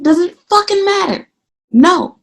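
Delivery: high, incredulous scoff; exasperated inner voice. Retold or imitated. Venting to viewers about the assistant, high incredulous scoff. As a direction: disbelief at a petty rule.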